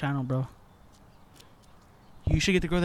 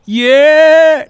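loud shout; 11 semitones higher, 17 dB louder than usual. Real, not acted. Teasing shout of confirmation.

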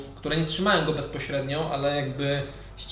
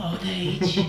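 eerie voice in head; 4 semitones higher than usual. Acted. Creature voice in head.

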